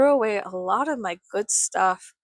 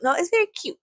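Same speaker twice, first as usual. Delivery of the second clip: high protesting cute; 9 semitones higher, faster than usual. Real, not acted. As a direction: squeal it's very cute, much higher. Protesting rebuttal.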